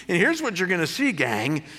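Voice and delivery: ordinary talk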